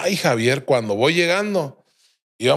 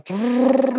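phone ring imitation; 11 semitones higher than usual. Phones ringing at dawn.

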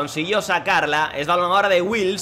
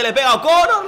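excited shot call; 9 semitones higher, 6 dB louder, faster than usual. Real, not acted. Shot on goal, voice jumps.

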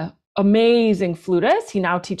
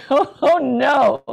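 slow, higher, louder wail of mock dismay; mock panic; 6 semitones higher, 5 dB louder, slower than usual. Flutist recalling her first yoga gig, in mock panic. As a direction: ham up the panic, half laughing. Retelling panic at having to improvise.

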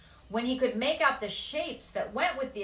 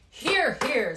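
hearty approval; 6 semitones higher, 10 dB louder than usual. Acted. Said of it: "said the mayor".

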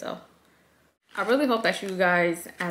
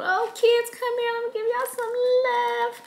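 much higher tender sing-song; sing-song loving call; 14 semitones higher than usual. Retold or imitated. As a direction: call the kids over lovingly. Happy mom calling her kids.

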